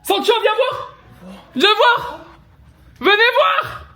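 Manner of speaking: high excited shout to a friend; yelling across the room. Real, not acted. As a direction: call them over, thrilled. Calling friend to come see.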